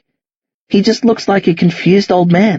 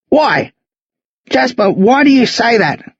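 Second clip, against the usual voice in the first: higher, pained questioning; thick, raised voice; 6 semitones higher than usual. Acted. Old man asking boy why, thick raised voice. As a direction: plead for a reason, mildly choked up. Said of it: "Lionel's voice is thick and raised".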